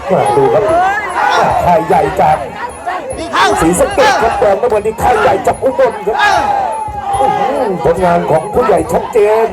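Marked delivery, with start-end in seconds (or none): 3.6-9.5 fast, lively ringside call; excited boxing commentary. Real, not acted. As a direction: call the fight with rising energy. Live play-by-play between fighters.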